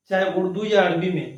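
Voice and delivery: ordinary talk, nothing marked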